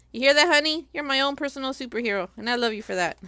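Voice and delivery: loud teasing call to husband. Real, not acted. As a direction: call out so honey hears. Calling out to husband.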